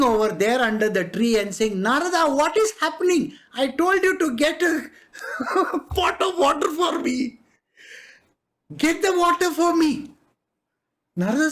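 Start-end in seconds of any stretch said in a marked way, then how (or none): 1.8-10.1 high, insistent reminder with a laugh breaking in; acted scolding, amused and pitched up; chiding call. Narayana under the tree asks Narada for his water, chiding.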